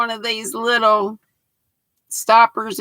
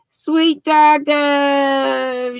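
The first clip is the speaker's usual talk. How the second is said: ordinary delivery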